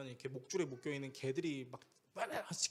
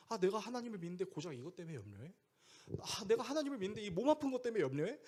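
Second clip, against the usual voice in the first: self-reproach; 6 semitones higher, 4 dB louder than usual. Acted. Voicing a self-reproaching worrier.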